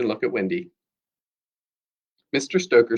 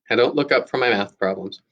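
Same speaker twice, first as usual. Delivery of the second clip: ordinary delivery, nothing marked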